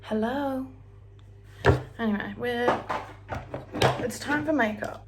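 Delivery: normal talking voice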